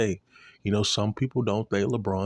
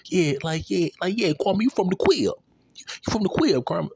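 mocking Shannon; 10 semitones higher than usual. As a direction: ham up Shannon teasing Kwame, pitched way up. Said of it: "Saying shit like".

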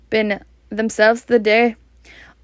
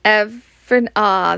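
plain reading voice

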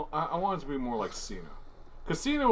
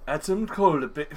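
William Regal; 3 semitones higher than usual. Retold or imitated. Echoing a friend's wrestler impression, mock British voice. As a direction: try a put-on British catchphrase. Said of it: "how would William Regal say".

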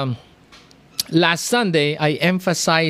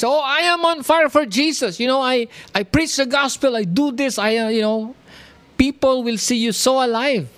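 higher, fast boastful claim; 7 semitones higher than usual. Retold or imitated. Preacher mocking a lukewarm believer who boasts, higher and fast. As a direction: mimic a proud, showy believer. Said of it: "you can claim that you are on fire".

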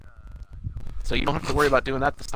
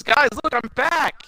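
much higher, eager call; excited Impmon; 10 semitones higher, slower than usual. Retold or imitated. Impmon running to his old tamers, eager call. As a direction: call out happily, pitched way up. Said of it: "He goes".

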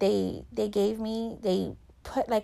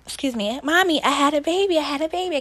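put-on new mother gasping at her baby; excited; 6 semitones higher than usual. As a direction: call out the news to Mommy in disbelief. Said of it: "then I was just like".

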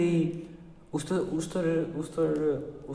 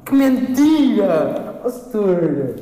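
high, loud and drawn-out cry of disbelief; mock outrage; 7 semitones higher, 11 dB louder, much slower than usual. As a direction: stretch out a hammed-up gasp of outrage. Accusing teacher of lying.

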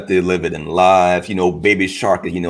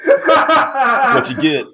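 laughing; 4 dB louder, slower than usual. Real, not acted. Laughing at the clip's fall.

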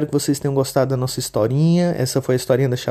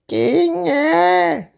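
put-on very high, frail old granny voice; a sweet old granny calling from bed; old grandma; 4 dB louder than usual. Vovó atende a porta. (vovozinha de voz aguda e frágil)

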